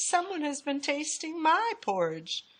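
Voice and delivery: high mama bear voice, ordinary volume; middle-sized Mama Bear voice. A narrator's reading. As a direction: pitch it up as an indignant mama bear. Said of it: "said Mama Bear in her middle-sized voice".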